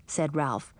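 plain reading voice

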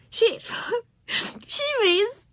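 high, breaking up mid-phrase; helpless acted giggling, gasped words; gasping with laughter. A narrator's reading. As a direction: laugh so hard the words barely get out. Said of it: "she gasped".

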